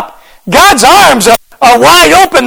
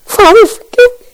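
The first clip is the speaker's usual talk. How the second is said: crying, voice breaking, higher; tearful plea; 9 semitones higher than usual. Acted. Repentant sinner to the Father, crying and pleading. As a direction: sob it out as you fall into his arms. Sinner melting into God's arms.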